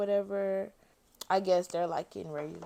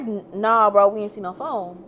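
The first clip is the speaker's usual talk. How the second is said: loud denial; 10 dB louder than usual. Retold or imitated. Friend denies, getting aggressive.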